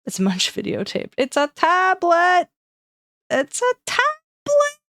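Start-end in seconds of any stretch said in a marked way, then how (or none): 1.2-2.5 higher, slow, louder correction; high drawn-out correction. Pretend. Repeating with rising silliness. 3.3-4.8 very high, slow squeak; squeaky silly voice. Pretend. Repeating even higher.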